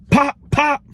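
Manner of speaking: high, sharp pop pop; mock gunshots. Narrator making the sound of two shots.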